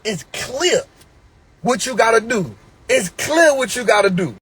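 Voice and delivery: loud, driving sermon delivery; fired-up preacher hammering his message; full-force preaching. Acted. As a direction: preach it hard at the crowd. Preaches loudly to his audience.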